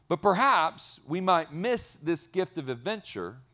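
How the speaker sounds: ordinary talk, nothing marked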